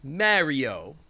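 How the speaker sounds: put-on Mario in a New York accent; imitated New Yorker pronunciation. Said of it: "they say it like a New Yorker".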